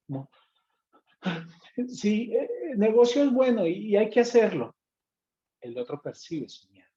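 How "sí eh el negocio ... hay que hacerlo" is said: higher, louder nervous pitch; a nervous salesman's forced confidence; fearful voice. Shown as a demonstration. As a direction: sound anxious while selling. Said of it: "Han escuchado voces de miedo".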